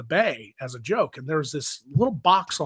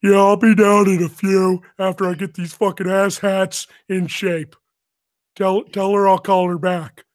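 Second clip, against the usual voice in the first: loud, raised sergeant voice; Technical Sergeant Ramscock; 6 semitones higher, 7 dB louder than usual. Retold or imitated. Posing as the T I to headquarters over the box, gruff bluff. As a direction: bluff it in the drill instructor's voice.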